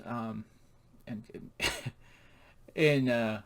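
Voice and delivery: ordinary delivery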